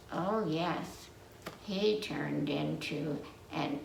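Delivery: usual speaking voice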